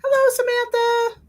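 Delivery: sing-song greeting to a viewer; high sing-song hello. Real, not acted. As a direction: greet high and bright, stretched out.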